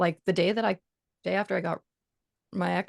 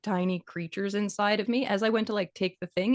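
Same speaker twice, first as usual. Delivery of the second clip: ordinary talk, nothing marked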